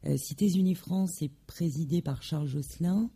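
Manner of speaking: normal talking voice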